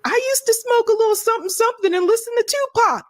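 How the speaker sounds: high, fake-casual put-on voice; a high, phony attempt to sound relatable; mocking Kamala Harris.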